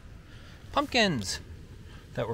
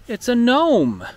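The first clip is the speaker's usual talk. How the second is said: sudden high, loud surprise at the find; surprised; 9 semitones higher, 7 dB louder, slower than usual. Real, not acted. Showing viewers the garden, surprised and delighted. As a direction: pop up with delight.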